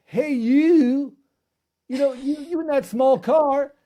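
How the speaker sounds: a hammed-up Texan trucker teasing, in a Texan accent. Host imitating a Texan driver mocking his small car, hammed-up Texan accent. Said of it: "Texan accent".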